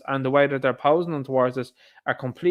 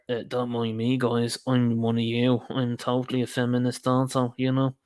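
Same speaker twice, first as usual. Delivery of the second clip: lower, meek mocking voice of a timid ally; mock meek soy guy; 3 semitones lower than usual. Vlogger mocking a bearded man at the rally, meek mock voice. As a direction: sneer at him by playing him small and meek. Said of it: "Hanging around there".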